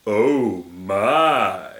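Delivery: very slow, low, drawn-out exclamation; George Takei. Acted. Aside in parentheses from the story, George Takei impression. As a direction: stretch both words out in a put-on deep voice. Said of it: "That's my George Takei impression".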